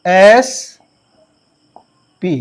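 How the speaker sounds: SP stretched very slowly, each letter held; drawn-out spelling. Shown as a demonstration. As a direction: draw out SP letter by letter so the ए sound is heard. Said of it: "अब ध्यान देना". (SP को बहुत धीरे खींचकर बोलना)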